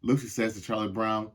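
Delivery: plain reading voice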